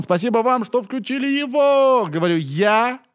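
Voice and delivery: high, slow goofy clown voice; silly clown. Acted. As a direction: ham it up like a clown. Silly intro thanks.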